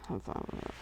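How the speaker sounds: whispered under her breath. Real, not acted. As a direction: breathe it almost silently.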